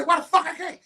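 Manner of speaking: high-pitched acted indignation; indignant challenge. Debater challenging his opponent's ban, indignant challenge. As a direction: demand a reason, replaying the row. Heated back-and-forth debate retold.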